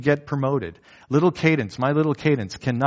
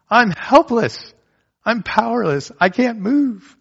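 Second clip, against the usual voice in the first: put-on high whiny child plea; 10 semitones higher, 4 dB louder than usual. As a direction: play a toddler begging to be carried. Daughter's plea voiced in sermon.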